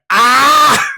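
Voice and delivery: loud, high imitated scream; mock monster scream. Imitating the burning monster flailing on the ground, loud screaming. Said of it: "just starts screaming like".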